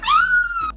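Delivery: high, loud mock scream; imitated scream. Girls fleeing the classroom, high screaming. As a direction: shriek like a panicking classroom. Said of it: "Everybody's screaming".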